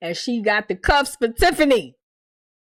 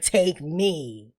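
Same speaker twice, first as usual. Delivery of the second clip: slow mocking Victor; 8 semitones lower, 5 dB quieter than usual. Mocking Victor's line again.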